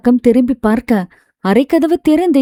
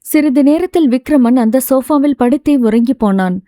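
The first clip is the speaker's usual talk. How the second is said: usual speaking voice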